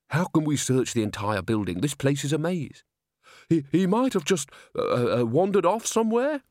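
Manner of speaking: flustered manager stumbling over words; nervous, halting, trying to stay calm; anxious stammer. Acted. Salzella worried, stammering.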